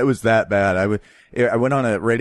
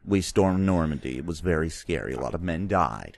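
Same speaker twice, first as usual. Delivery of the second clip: grave soldier remembering, quiet and low; World War Two Tom Hanks; 6 semitones lower, 4 dB quieter than usual. Acted. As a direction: somber WWII Tom Hanks, low and soft. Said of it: "World War Two Tom Hanks".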